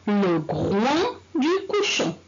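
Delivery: Le groin du cochon, slowly with each word apart; slow, careful teacher read-aloud; slow model reading. Shown as a demonstration. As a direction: read slowly so pupils can follow. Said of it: "Répétez".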